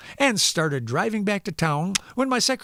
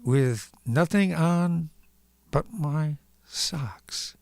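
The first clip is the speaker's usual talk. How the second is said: slow punchline; much slower than usual. Acted. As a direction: let the punchline land slowly. Punchline of the joke.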